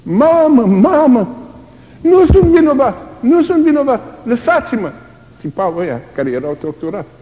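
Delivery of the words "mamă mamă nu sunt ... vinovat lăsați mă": high, loud pleading cry; imitated desperate scream. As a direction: scream like a beaten prisoner. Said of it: "strige mama". (imitând strigătul disperat)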